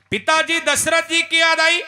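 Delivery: high, loud, shouted worry; anxious calling. Acted. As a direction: demand to know why he cries. Rama questions weeping Lakhan.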